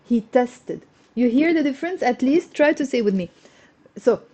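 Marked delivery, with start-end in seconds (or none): none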